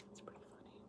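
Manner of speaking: whispered under breath; repeating her own remark to herself in a whisper. Real, not acted.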